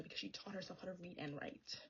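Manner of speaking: hushed aside; quiet secret-telling whisper. Real, not acted. Whispered aside about Lila.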